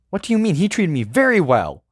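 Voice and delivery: high, loud outburst; exclaiming. Acted. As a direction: protest in loud disbelief. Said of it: "I exclaim".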